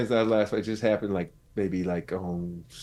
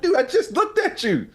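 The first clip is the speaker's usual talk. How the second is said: high, disbelieving complaint; exasperated at dog. Retold or imitated. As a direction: squeal it at the dog. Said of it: "I'm like".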